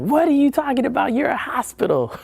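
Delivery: high, incredulous mock outrage; mock outraged friend. A friend mocking the hospital with no ER, high mock outrage. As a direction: play the friend scoffing at the hospital. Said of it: "so she's mad".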